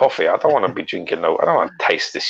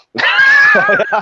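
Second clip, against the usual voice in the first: high laugh; 7 dB louder than usual. Real, not acted. Laughs at himself.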